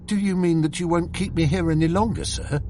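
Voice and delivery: a quick, pleading question, a touch of despair; despairing plea. A narrator's reading. Dismissed clerk pleads with his employer, quick and mildly despairing. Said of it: "I asked in despair".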